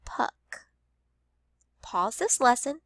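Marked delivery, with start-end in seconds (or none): 0.0-0.7 Puck, unvoiced and quiet; soft whispered Puck; whispered word. Shown as a demonstration. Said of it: "The first word that you're going to build is".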